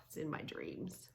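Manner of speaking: normal talking voice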